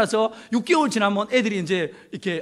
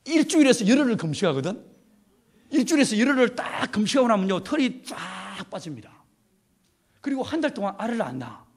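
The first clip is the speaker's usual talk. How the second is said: usual speaking voice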